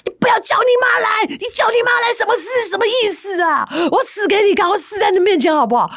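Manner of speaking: husband shouting and crying at his wife; husband's crying shout. Retold or imitated. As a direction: scream it through sobs. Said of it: "然后那个男的就说".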